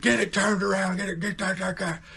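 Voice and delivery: high mock rant breaking into gibberish; imitating yelling dad. Dad yelling from up on the hill, mock angry rant. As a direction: rattle off dad's angry holler. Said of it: "yelling this stuff".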